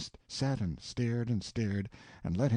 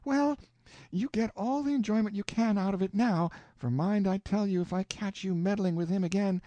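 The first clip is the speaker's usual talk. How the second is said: put-on higher, lightly threatening old woman; warning aunt; 6 semitones higher than usual. A narrator's reading. Aunt warning Tom, a hint of threat. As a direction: wag a finger with your voice. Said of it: "and she says".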